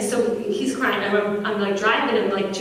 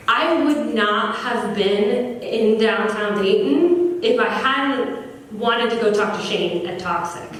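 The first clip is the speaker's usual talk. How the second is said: ordinary talk, nothing marked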